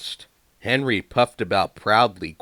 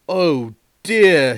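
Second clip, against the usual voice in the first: dismayed; 8 semitones higher, 10 dB louder than usual. A narrator's reading. Thomas dismayed.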